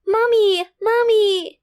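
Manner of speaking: put-on high, loud child's cry for mommy; tearful calling out. A narrator's reading. Girl running to her mother, crying out loud and high. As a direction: call out as a crying little girl. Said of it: "Umiiyak nitong tawag sa kanya".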